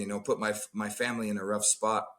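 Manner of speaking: ordinary talk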